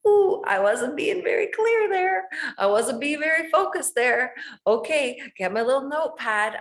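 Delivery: high, loud sing-song quote of an upbeat self; cheery self-talk. Retold or imitated. Imitating her upbeat inner voice to listeners, high and loud. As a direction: chirp it like a happy little discovery. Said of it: "I'm gonna say".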